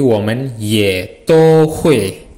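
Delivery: slow, even "Women ye to hui xie hanzi"; slow Mandarin model. Shown as a demonstration. Teacher repeats the sentence slowly for the class. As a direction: read it out carefully for learners.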